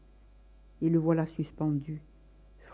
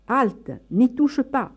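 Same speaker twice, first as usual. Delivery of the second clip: ordre sec; 6 semitones higher, 6 dB louder, slower than usual. Acted. Père surprend sa femme la nuit.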